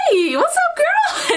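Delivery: very high put-on greeting; hammed-up casual hello; mock casual flirt.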